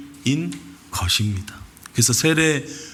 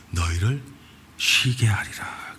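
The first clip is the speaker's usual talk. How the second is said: tender whisper; 5 semitones lower, 4 dB quieter, slower than usual. Retold or imitated. Jesus promising rest.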